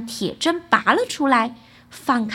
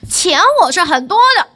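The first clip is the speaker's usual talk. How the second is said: loud, higher, quick reply; cocky boast; 5 semitones higher, 9 dB louder, faster than usual. A narrator's reading. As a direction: boast it briskly to the monsters. Boy boasts to monsters. (响亮轻快地夸口)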